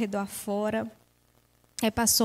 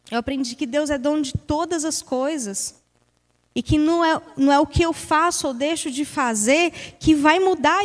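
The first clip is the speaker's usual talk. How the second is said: louder and higher, preaching God's faithfulness; fervent testimony; 4 semitones higher, 5 dB louder than usual. Real, not acted. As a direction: rise into a heartfelt sermon. (pregação fervorosa, mais alta e aguda)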